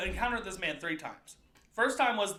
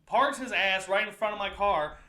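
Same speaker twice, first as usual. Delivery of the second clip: ordinary talk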